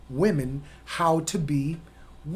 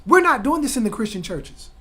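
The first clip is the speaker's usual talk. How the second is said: usual speaking voice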